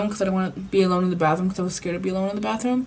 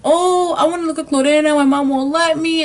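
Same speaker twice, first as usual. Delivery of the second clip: put-on kid self whining; 7 semitones higher than usual. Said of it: "I remember that I was like".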